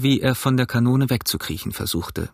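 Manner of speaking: ordinary delivery, nothing marked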